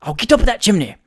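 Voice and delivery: urgent, determined outburst; in earnest. A narrator's reading. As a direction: declare it forcefully, meaning every word. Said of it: "who really meant what he said".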